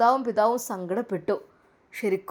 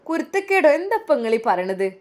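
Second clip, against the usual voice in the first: shot up higher and louder in outrage; indignant echo; 4 semitones higher, 6 dB louder than usual. Acted. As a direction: throw the word back at him. Daughter echoes insult indignantly.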